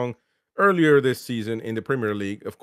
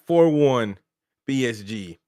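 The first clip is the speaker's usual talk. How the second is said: normal talking voice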